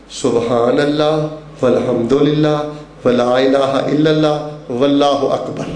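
low, slow recital; drawn-out, low dhikr; dhikr recitation. Shown as a demonstration. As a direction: recite each phrase slowly, low, with pauses. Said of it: "ਕਿਹੜਾ ਵਜ਼ੀਫਾ".

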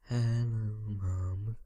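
drawn-out, level-toned hello; singsong greeting. Pretend. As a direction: call out playfully, stretching the vowels. Calling to mammoth in singsong.

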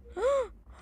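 high frightened gasp; startled gasp. Real, not acted. Jump scare in horror game. (arfada assustada)

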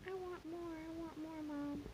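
high, soft begging pet voice; pleading cat voice. Acted. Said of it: "He's like".

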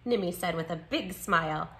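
ordinary delivery, nothing marked

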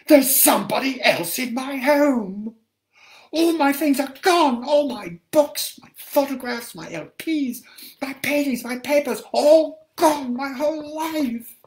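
high, loud, frantic shout. Acted. Woman in pink coat screaming into the narrator's face about her home. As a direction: yell it right into his face. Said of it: "shouted into my face".

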